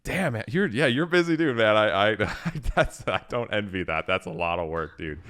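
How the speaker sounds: ordinary talk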